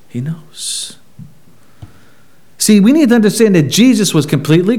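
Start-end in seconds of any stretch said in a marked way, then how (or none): 0.1-1.0 whisper. Real, not acted. Whispered aside.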